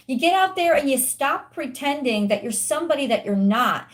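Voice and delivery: normal talking voice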